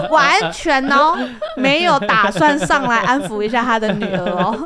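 loud words through laughter; laughing. Real, not acted. Telling friends the husband never came back, laughing loudly. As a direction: complain while still laughing.

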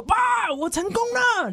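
high-pitched mock-jubilant son; imitating happy announcement. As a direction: act out running up to dad with big news. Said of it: "他就很高兴跑去跟李敖说".

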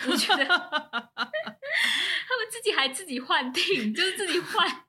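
slow words broken by giggles; laughing through the words; stifled giggles. Real, not acted. Recalling funny scene, giggling.

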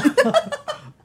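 high burst of laughter. Real, not acted. Guest laughs at host joke.

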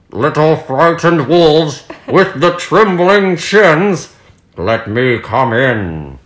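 sly pig voice. Acted. Big bad pig at door.

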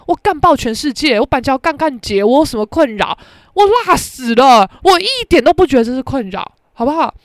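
loud, swaggering boast; sassy swagger; loud brag. Real, not acted. Boasting defiantly.

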